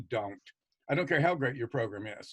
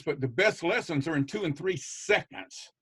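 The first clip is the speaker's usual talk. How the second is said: ordinary talk, nothing marked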